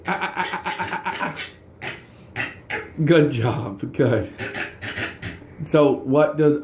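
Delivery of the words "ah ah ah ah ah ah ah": soft, high dolphin clicks; dolphin chatter. Shown as a demonstration. Parent showing the dolphin's sound to a child, soft high chatter. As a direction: chirp it softly like a dolphin. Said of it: "Dolphins say".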